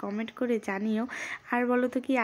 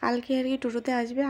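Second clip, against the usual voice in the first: ordinary delivery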